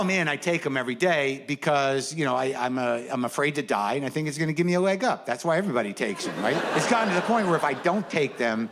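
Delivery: ordinary delivery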